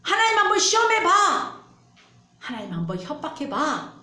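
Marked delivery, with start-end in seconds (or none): 0.0-1.6 loud taunt. Acted. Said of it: "마귀가 그렇게 얘기하고 있어요".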